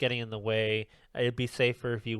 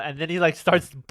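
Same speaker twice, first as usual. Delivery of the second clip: ordinary talk, nothing marked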